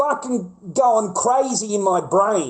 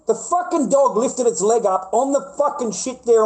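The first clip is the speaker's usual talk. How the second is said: ordinary talk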